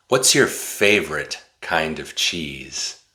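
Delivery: ordinary delivery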